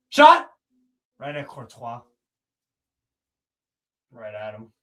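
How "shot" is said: high, loud rising "Shot!"; excited call. Real, not acted. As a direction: yell it as the ball flies. Calling a shot live.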